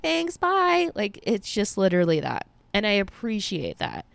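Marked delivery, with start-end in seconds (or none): 0.0-0.9 high, quick cheery goodbye; chirpy sign-off. Retold or imitated. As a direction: wrap it up cheerily, pitched up. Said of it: "And then I'm like".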